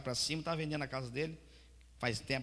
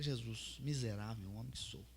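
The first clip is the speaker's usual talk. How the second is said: quiet confession; 5 semitones lower, 9 dB quieter than usual. Retold or imitated.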